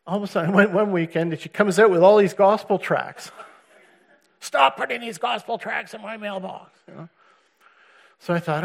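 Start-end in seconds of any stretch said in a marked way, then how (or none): 4.4-6.7 higher, sharp complaint from Susan; mock the irritated ex-wife; a hint of a fed-up wife's voice; annoyed wife. Wife confronts him with tracts.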